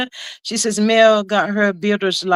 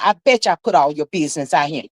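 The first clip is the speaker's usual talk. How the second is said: ordinary talk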